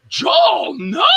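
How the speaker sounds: very high, loud scolding; exasperated mock shout. Director stopping an actor's wild ideas, exasperated high mock shout. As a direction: cut them off with a high yell.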